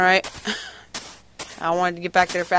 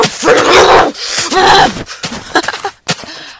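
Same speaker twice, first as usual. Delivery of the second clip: giggle fit; 10 semitones higher, 7 dB louder than usual. Real, not acted. Hyper, giggling at himself.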